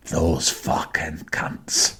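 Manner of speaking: slow whispered curse; venting under the breath. Retold or imitated. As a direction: whisper it slowly behind a closed door. Retold venting behind closed door.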